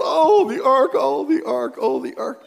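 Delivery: high wailing mock panic, fading; hammed-up frantic Israelites. Preacher mocking Israel fretting over the ark, high wail. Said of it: "Israel's going".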